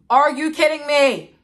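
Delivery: loud, high-pitched disbelief; outraged shout. Real, not acted. Mother telling followers about her partner's priorities, outraged shout. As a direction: burst out in indignant disbelief.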